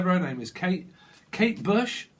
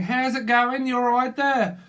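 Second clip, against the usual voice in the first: put-on Australian accent; 7 semitones higher, 4 dB louder than usual. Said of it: "Someone's from Australia".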